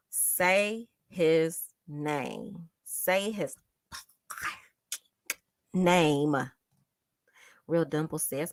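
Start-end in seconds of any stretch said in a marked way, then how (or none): 0.1-6.4 word by word, with long gaps; solemn, stretched-out demand; very slow slogan. Real, not acted. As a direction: let each word stand alone. Slogan chanted slowly, long gaps.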